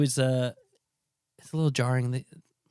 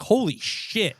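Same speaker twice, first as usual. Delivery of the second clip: high startled "Holy shit"; mock shock; 8 semitones higher, much slower than usual. First-timer hearing a Delco accent, mock shock. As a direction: act floored. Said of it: "being like".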